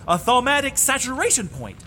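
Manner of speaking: usual speaking voice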